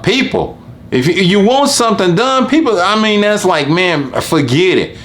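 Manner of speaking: high, exasperated outburst. Real, not acted. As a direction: throw up your hands, voice pitched high. Venting about indecisive people.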